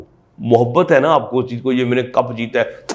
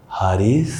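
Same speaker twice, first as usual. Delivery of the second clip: loving; 4 semitones lower, slower than usual. Shown as a demonstration. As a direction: say the name tenderly, drawn out. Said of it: "मोहब्बत से उसका नाम लेती है".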